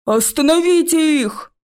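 high, loud shout; loud commanding yell. Acted. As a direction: order them stopped, yelling. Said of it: "закричал старший".

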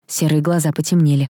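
ordinary delivery

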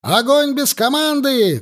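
high-pitched cry of command; shouted order. A narrator's reading. Officer orders his line to fire at will, shouting. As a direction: call it out sharply to the troops. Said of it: "крикнул Егоров".

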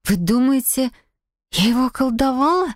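high, stunned question climbing at the end; shocked. A narrator's reading. Wife accused of bewitching her husband, shocked. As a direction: ask in disbelief, pitch rising high. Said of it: "потрясённо прошептала я".

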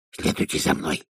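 hushed, secretive whisper. A narrator's reading. Dee leading Vimes off at midnight, whispering. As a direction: whisper it as an order. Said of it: "прошептал Ди".

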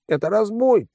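high-pitched outraged accusation; indignant outburst. A narrator's reading. Financier accusing his extorters of robbery, flaring up indignantly. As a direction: flare up in protest, pitch jumping. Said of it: "тут же взвился финансист".

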